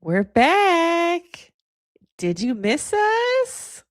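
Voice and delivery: very high, drawn-out socialite greeting; Kim K. Acted. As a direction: stretch the endings high and upward. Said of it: "the Kim K voice".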